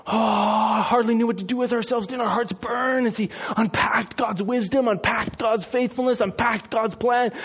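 high-pitched, building excitement; rapturous wonder. Acted. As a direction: gush it like an overwhelmed disciple. Acting disciples' burning excitement.

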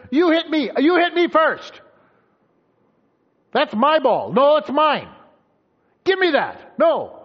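put-on loud, high kids' quarrel; squabbling children. Kids fighting outside Mom's window, indignant yells. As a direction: imitate kids yelling accusations back and forth.

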